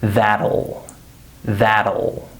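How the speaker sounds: THAT'LL pronounced "thaddle"; THAT'LL in two syllables, "thad-ul". Shown as a demonstration. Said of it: "the letter T becomes a D sound".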